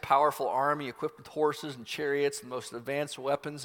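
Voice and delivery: normal talking voice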